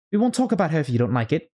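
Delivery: put-on fast, high girlish promise; hurried Alice. Alice quickly reassuring the mouse, fast and high. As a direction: rush the words to soothe.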